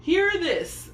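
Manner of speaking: high, loud herald's call; shouted. A narrator's reading. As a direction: call it out over the crowd. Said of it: "they shouted".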